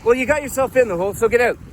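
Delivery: high, brisk retort; exasperated, quick-fire order; impatient insistence. Acted. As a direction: push back with no sympathy. Rescuer insists, unsympathetic.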